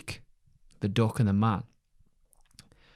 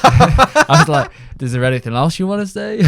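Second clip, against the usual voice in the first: laughing through the line; 7 semitones higher, 12 dB louder than usual. Real, not acted. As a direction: crack up while telling it. Laughing while retelling.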